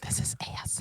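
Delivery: voiceless playful whisper; ASMR whisper. Pretend. Co-host explaining the joke to friends, whispering. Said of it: "Why are you whispering?".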